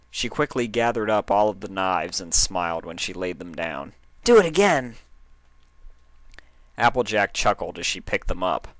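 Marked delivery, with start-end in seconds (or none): 4.2-5.0 loud high excited demand; eager plea. Acted. Rainbow Dash urging Applejack to throw again, high and loud. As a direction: beg like an eager kid.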